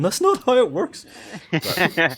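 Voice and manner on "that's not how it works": much higher, louder mock-wailing game master; whiny crying DM. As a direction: wail it like a heartbroken rules-lawyer DM. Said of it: "a D M somewhere crying, going".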